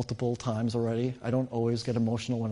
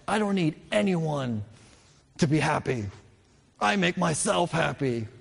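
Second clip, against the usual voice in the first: higher, mock-proud boast; bravado and pride; 4 semitones higher than usual. Young man telling his parents he needs nobody, proud. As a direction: brag a little, chin up, half-joking. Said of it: "with a little bit of bravado and pride".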